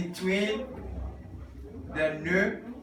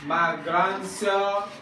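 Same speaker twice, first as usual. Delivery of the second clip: slow, stretched-out syllables; spaced out for repeating; slow model reading; 3 semitones higher, much slower than usual. Shown as a demonstration. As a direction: model it slowly for the class. Models French slowly for class to repeat.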